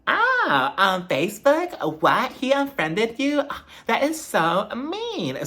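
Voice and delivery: high, slow exaggerated gasp of disbelief; mock shock. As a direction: ham up the outrage, drawn out. Mocking Mia's fake shock.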